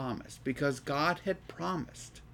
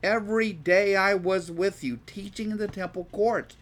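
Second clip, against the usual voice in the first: higher, louder projected Bible reading; scripture reading aloud; 4 semitones higher, 6 dB louder than usual. Acted. Teacher reads Jesus challenging his captors, forceful scripture reading.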